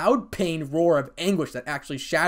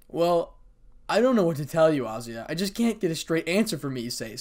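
ordinary delivery

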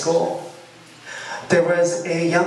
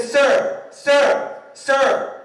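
high, insistent retold call; calling after someone; 8 semitones higher, 3 dB louder, much slower than usual. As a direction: try to get a passerby's attention. Voices young man calling after him.

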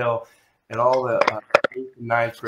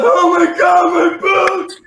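very high, loud mock shriek; panicked cry; 8 dB louder, slower than usual. Boy sees his boots melted to the drum, panicked shriek.

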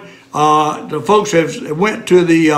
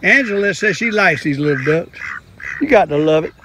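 ordinary delivery

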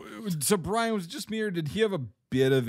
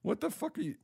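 shocked disbelief; 11 semitones higher than usual. Retold or imitated. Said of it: "I'm like".

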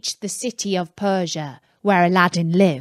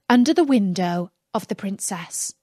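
usual speaking voice

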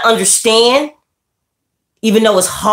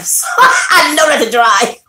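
laughing; 8 semitones higher, slower than usual. Real, not acted. Joking about drinking.

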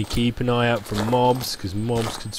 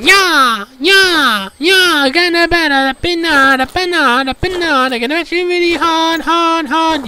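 high, loud mock-frenzied kids' chant; children battering a pinata; 6 dB louder than usual. Gamer imagines children battering a pinata with a hammer, hammed-up high yelling. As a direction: squeal it out like kids whacking a pinata, much higher and louder. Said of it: "they really batter that thing like".

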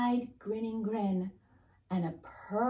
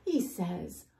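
ordinary delivery

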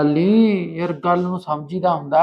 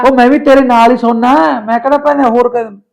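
raised, higher voice, begging her; loud pleading; 7 semitones higher, 8 dB louder than usual. Acted. Man begging his lover over the broken fan, loud and high. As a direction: plead loudly so she lets you stay.

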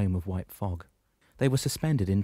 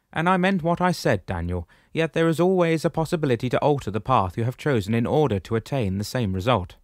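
higher, louder commanding shepherd voice; booming old shepherd, pitched up; 5 semitones higher, 6 dB louder than usual. Acted. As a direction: address him firmly from above. Damianos speaks.

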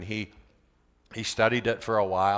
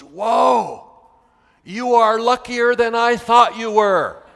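high, loud astonished exclamation, slowed at the end; amazed student; 9 semitones higher, 9 dB louder, slower than usual. Retold or imitated. Student marvels at his teacher's luck, loud and astonished. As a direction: burst out in wide-eyed amazement, much higher and louder.

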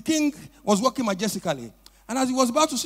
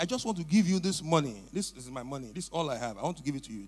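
humble, soft offering; 9 semitones lower, 10 dB quieter than usual. Acted. Poor man offering money humbly.

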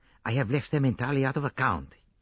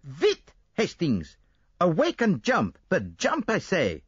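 higher, louder Belgian detective urging haste; urgent shout; 9 semitones higher, 3 dB louder, slower than usual. A narrator's reading. Poirot rousing dozing Hastings on the train, urgent higher shout. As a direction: jolt your friend awake. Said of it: "uttered a piercing squeal".

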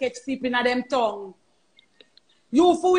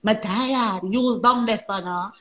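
mock tired tongue; 4 semitones lower, slower than usual. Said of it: "your tongue always a say".

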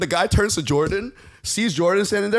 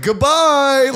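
high, loud, drawn-out goodbye; gleeful jeering farewell shout; mocking send-off; 8 semitones higher, 6 dB louder than usual. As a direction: call it out after someone being thrown out. Bar mockingly sends off ejected man.

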